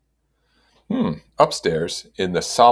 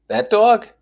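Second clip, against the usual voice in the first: high, loud hawker selling puppies; street dog seller; 5 dB louder than usual. A narrator's reading. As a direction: pitch the seller up, pushing a sale. Seller voiced.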